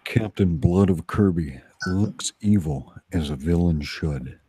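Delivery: normal talking voice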